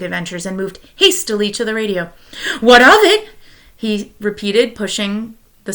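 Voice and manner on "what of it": high, loud "What of it?"; eager echo. Acted. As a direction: fire it back keenly. Husband echoes wife, eager.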